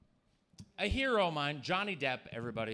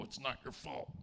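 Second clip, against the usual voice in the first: soft, hushed "it's not your fault", much softer; mock tender comfort; 2 semitones lower, 9 dB quieter than usual. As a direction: hug the kid and murmur gently. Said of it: "hug 'em and pull 'em in and go".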